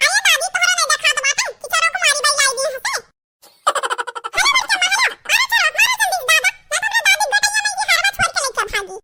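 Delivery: put-on a little boy yelling to beat the thief; excited small boy. Boy yells to his grandmother to beat the thief, high excited shouting.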